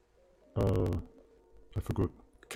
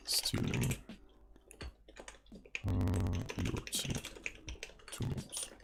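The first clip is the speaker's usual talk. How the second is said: whisper; 3 semitones lower, 11 dB quieter than usual. Real, not acted. Sneaking off for tea.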